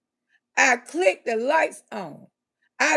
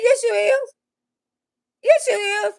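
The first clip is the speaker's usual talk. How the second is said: insistent; 7 semitones higher, 3 dB louder than usual. Retold or imitated. Insisting to boyfriend.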